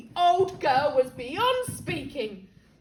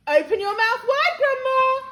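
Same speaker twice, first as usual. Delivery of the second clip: much louder, higher call to grandma; loud coaxing; 9 semitones higher, 10 dB louder than usual. Acted. George coaxing grandma loudly.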